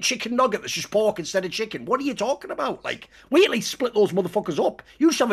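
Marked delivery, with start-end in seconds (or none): none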